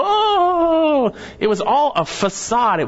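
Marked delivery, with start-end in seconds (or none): 0.0-1.1 high hammed-up mourner's wail; mock wail. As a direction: imitate a paid mourner's fake cry. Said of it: "people who would come in and wail".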